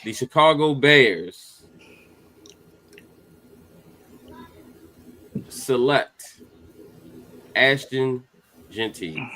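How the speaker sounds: slow, formal draft-pick announcement; draft announcer. Acted. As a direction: announce it like the commissioner at the podium.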